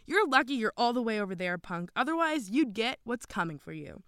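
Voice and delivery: renewed anger. A narrator's reading. Jacob threatening the boy who blamed him, angry. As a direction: threaten the punk with a touch of temper. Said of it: "face turned red with renewed anger".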